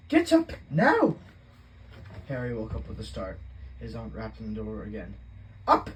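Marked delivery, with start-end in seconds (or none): 0.1-1.2 much higher, loud and slow, drawn-out shriek; Aunt Petunia's shrill wake-up cry. A narrator's reading. Aunt yelling through the cupboard door, shrill and loud. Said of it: "her shrill voice". 5.7-5.9 a short high screech. A narrator's reading. Aunt rapping on the door, screeching. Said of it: "she screeched".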